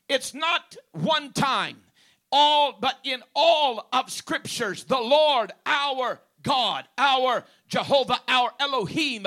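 rising pulpit intensity; fervent preaching. Real, not acted. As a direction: drive it home like a preacher. Preaching at full force.

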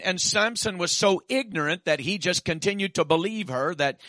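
ordinary talk

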